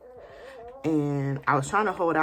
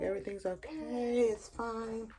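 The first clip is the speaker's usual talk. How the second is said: soft, higher soothing mom voice; reassuring mom; 5 semitones higher, 6 dB quieter than usual. Retold or imitated. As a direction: hush her gently like a worried mother.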